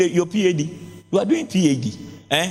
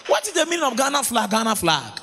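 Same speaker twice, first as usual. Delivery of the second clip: high, chanted preaching; mock the church names in a singsong; preaching at full force; 9 semitones higher than usual. Pastor mocking church names in a sung preach.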